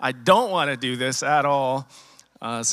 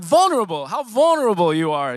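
imitating Ernie, higher and louder on vulnerable; quoting Ernie; 5 semitones higher, 5 dB louder than usual. Ernie naming the speaker's openness, mock voice higher. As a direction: land on the word like a friend finding it. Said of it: "He was like".

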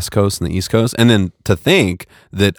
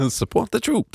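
higher playful newsreel voice; mock war announcer; 6 dB quieter than usual. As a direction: chime in like an old war radio. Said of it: "World War Two one".

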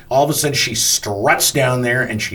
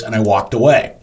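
usual speaking voice

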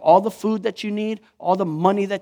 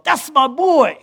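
put-on a high, fond coo to a little child; proud loving dad voice; doting father; 7 semitones higher, slower than usual. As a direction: pinch the cheek with your voice. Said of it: "pop you on the nose and go".

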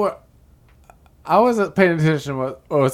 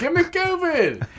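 mock plea; 13 semitones higher than usual. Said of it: "and I was like".

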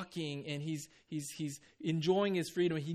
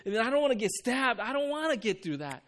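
higher, louder complaining voice; hammed-up sulky protest, higher pitched; mock whiny refusal; 4 semitones higher, 5 dB louder than usual. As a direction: whine like a spoiled kid refusing the job. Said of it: "He could have said".

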